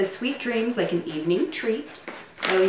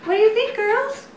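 high coaxing pet voice; dog-talk voice; 7 semitones higher than usual. Real, not acted. Owner asking her dogs to say bye. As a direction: coax the dogs sweetly, pitched up.

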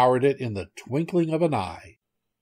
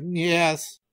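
higher-pitched animal reply; small, raised goat voice; 6 semitones higher than usual. Acted. Goat answers the tiger.

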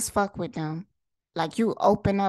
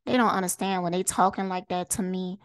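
ordinary talk, nothing marked

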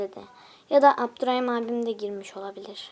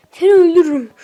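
much louder hammed-up threatening yell; mock threat; 4 semitones higher, 15 dB louder, faster than usual. To a viewer teasing about likes, mock angry shout. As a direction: bark the playful threat.

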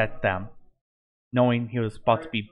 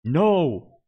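loud, higher, drawn-out yell; yelled cry; 5 semitones higher, 5 dB louder than usual. A narrator's reading. Morpheus as Herobrine is about to fall, loud yell. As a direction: cry out in protest, pitch swooping up and down. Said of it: "Morpheus yelled".

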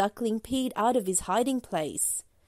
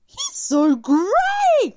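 high, loud, slow horsey voice; high, slow neighing horse voice; 12 semitones higher, 8 dB louder, much slower than usual. A narrator's reading. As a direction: stretch it out like a whinnying horse. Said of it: "neighed the horse".

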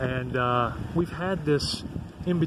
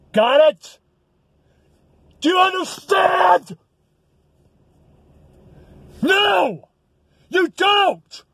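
shouting, much higher and louder; furious rant; 7 semitones higher, 4 dB louder, slower than usual. Real, not acted. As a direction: yell it at the viewers in real rage. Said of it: "I know I kind of raged out".

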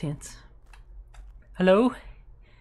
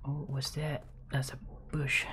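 whispering; 3 semitones lower, 9 dB quieter than usual. Real, not acted. Creeping through dark woods.

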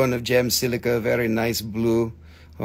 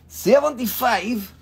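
high-pitched price echo; playful echo; 8 semitones higher than usual. Pretend. Seller repeats the price to buyers, playfully high.

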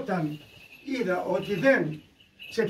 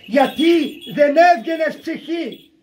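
high, loud, slow denunciation; thundering rebuke; 7 semitones higher, 10 dB louder, slower than usual. Acted. Preacher demanding why the wretched soul would not leave, thundering rebuke. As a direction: hurl each word at the sinful soul.

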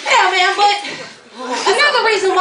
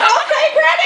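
cheering granny; 10 semitones higher than usual. Retold or imitated.

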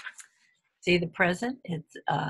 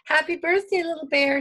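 high, loud birthday greeting; lively storybook Hen greeting; cheerful greeting; 10 semitones higher, 6 dB louder, faster than usual. A narrator's reading. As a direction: call it out high to the birthday bear.

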